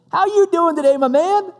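high, sing-out cheery greeting; grinning clerk. Retold or imitated. Store clerk greeting a customer, beaming. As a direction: greet a stranger with a huge grin in the voice. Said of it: "Huge smile".

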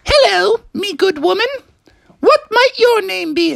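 put-on high-pitched, chipper old-time suitor; gallant frog greeting. Froggy politely greets a maiden in the field, high and courtly.

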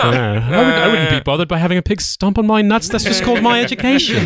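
high-pitched mock-British comic character; put-on British voice. Comedian riffing as a hardened royal, put-on British voice. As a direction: pitch it up into a silly English voice. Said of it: "Just stumbling into David Baddiel voice here".

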